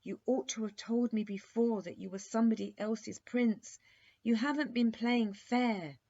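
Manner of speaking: loud, high, scolding princess; indignant demand. Acted. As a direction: demand it, hurt and furious. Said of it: "demanded the princess".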